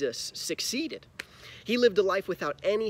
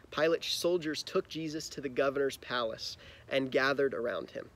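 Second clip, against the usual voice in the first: ordinary delivery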